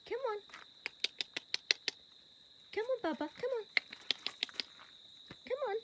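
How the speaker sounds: high, soft repeated calls; high coaxing. Real, not acted. Owner coaxing her horse, high soft calls. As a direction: coax him along, high and soft.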